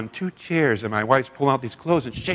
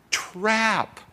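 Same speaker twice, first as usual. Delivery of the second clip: ordinary delivery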